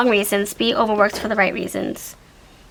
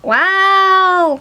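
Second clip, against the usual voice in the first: high, loud wow; amazed wow; 8 semitones higher, 4 dB louder than usual. Real, not acted. Reader amazed at the card she drew, high wow. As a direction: gasp it out in delight.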